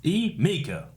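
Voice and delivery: Emiko in a Southern drawl, "ee-MAY-ko"; high-pitched southern take on Emiko; southern accent. Shown as a demonstration. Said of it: "The southern, southern accent".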